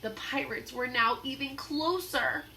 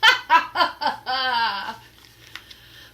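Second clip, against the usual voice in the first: booming villain laugh; loud pirate laugh; 3 semitones higher, 11 dB louder than usual. Acted. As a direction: laugh over the captives. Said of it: "Captain Bones laughed loudly".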